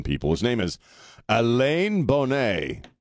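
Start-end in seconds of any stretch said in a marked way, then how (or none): 1.3-2.9 Alain Bonnet said slowly the French way, nasal vowel in Alain, Bonnet as bon-NAY; drawn-out, careful French Alain Bonnet with nasal ending; French pronunciation. Shown as a demonstration. Host to caller, showing off the French name: slow French pronunciation. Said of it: "Not Alan. It's Alain".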